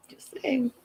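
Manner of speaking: very soft, under-the-breath tag; whispered aside. Real, not acted. Host slipping in a remark after criticism, whispered aside. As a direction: drop to a sly whisper.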